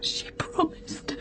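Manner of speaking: slow crying whisper; broken, frightened whisper; tearful whisper. Acted. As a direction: whisper it through tears. Wife terrified, child searching.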